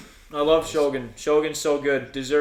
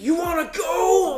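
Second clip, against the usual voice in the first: whiny shout; 7 dB louder than usual. Retold or imitated. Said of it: "and Ed's like".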